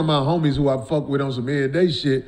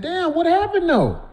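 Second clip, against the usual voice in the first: much higher, drawn-out what happened though; curious damn; 13 semitones higher than usual. Retold or imitated. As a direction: lift it high and slow, eager to hear the tea. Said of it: "by saying".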